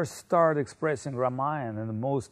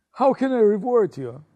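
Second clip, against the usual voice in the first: high, loud, moved exclamation; retelling Ram's astonished gratitude; overwhelmed king; 8 semitones higher, 9 dB louder than usual. Retold or imitated. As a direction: cry it out in grateful wonder. Ram overwhelmed by the boys.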